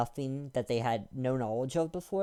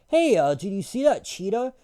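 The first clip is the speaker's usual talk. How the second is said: higher, louder mock of a know-nothing; mocking dumb voice; 6 semitones higher, 6 dB louder than usual. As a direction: ham up the eager ignorant guy. Mocking people who call cats cheetahs.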